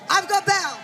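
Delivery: high, loud announcement shout; announcing loudly. Real, not acted. Organiser calls the next speaker to the stage, loud shout. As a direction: call the next speaker up to the crowd.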